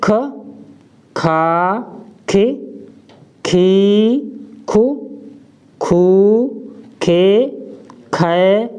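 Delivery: each syllable from ख to खह said apart with aspiration; slow syllable drill from ख to खह; kha barakhadi. Shown as a demonstration. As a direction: recite the matra row for kha. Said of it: "अब ख को देखते हैं".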